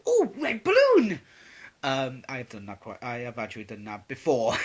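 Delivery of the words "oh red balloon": put-on high, loud, slow childlike wonder; sudden delight. Vlogger showing his ADHD tangents, awestruck childlike cry. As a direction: gasp it out as if dazzled by a balloon. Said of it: "I can literally just go".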